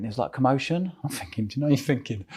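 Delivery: normal talking voice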